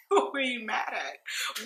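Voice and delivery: high laugh, then a soft, slow question. Real, not acted. Laughing while asking about Kenya's anger. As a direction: laugh it off, then ask who she is mad at.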